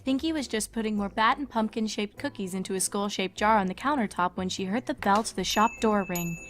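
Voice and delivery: ordinary delivery, nothing marked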